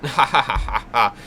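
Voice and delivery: hearty run of ha-ha-ha; put-on laughter for a game character; acted character laugh. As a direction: laugh it out in even, separate beats.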